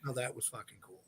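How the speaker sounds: imitating brother's hushed, low reaction; murmured low and very soft, quietly impressed; understated awe. Retelling brother Joe's reaction at the movie, in understated awe. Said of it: "my brother Joe never says anything like that".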